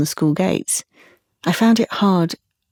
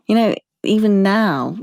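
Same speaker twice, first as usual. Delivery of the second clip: ordinary talk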